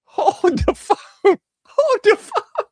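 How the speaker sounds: high, loud laughing disbelief; cracking up while stopping the clip; laughing hold up. Real, not acted. Commentator cracking up at a judge's name, laughing.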